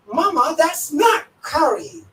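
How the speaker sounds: a mock-petulant young son; young son protesting. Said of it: "budak muda".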